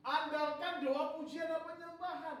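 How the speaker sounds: high, loud, slow proclamation; preaching proclamation. Real, not acted. Preacher announcing the second point to the church, loud.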